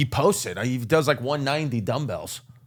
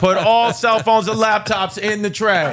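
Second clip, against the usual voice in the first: mock TSA agent; 7 semitones higher, 4 dB louder than usual. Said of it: "T S A's like".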